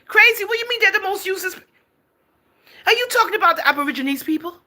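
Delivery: high, loud, shocked demand; outraged disbelief. Real, not acted. Host to viewer Crazy about his insult, outraged high shout.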